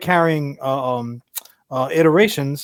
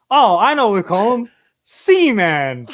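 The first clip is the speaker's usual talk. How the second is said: smug executive; 4 semitones higher, 3 dB louder than usual. Retold or imitated. Voicing the toy executive's idea.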